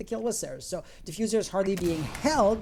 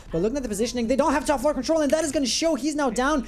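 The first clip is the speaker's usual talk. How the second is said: building hype, rising high and loud; excited; 6 semitones higher, 5 dB louder than usual. Real, not acted. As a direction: caster climbing to a big finish. Final clutch moment of the round.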